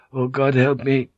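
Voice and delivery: desperate. A narrator's reading. Van Neck praying as he picks his straw, desperate. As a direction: plead desperately for help. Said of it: "asking himself desperately".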